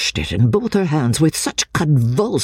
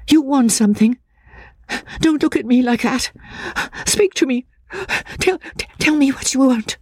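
high, pleading, stammering; agitated frightened woman; 12 semitones higher than usual. A narrator's reading. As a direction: beg nervously in a high woman's voice. Voices the distressed woman pleading.

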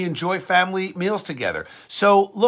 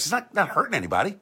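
ordinary talk

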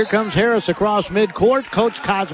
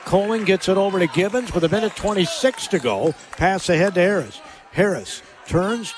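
ordinary delivery

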